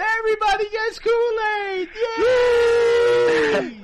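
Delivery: high, loud whooping shout building up; ecstatic shout. Pretend. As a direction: scream it like a giddy host. Mock host building to shout.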